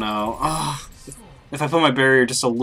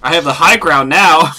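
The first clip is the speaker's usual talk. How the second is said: loud triumphant call; gloating shout; 8 dB louder than usual. Real, not acted. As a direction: crow about the win. Gloating over a kill.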